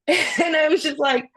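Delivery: real laugh breaking into the words. Real, not acted. Laughing at being called rapper.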